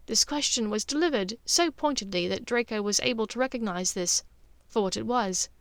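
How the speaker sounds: ordinary delivery, nothing marked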